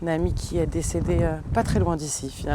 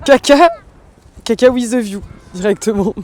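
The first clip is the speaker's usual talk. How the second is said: excited; 4 semitones higher, 8 dB louder than usual. Real, not acted. Laughing at dog mess by sea.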